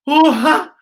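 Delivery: high, loud delighted ooh; surprised. A narrator's reading. Teacher voicing Z, surprised and pleased. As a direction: caught off guard by good luck. Z's luck, shock.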